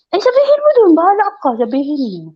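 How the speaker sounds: high, loud teasing dare; mock taunt. Daring the coworker to tell the boss, high teasing. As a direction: dare her, half laughing. Her retold retort.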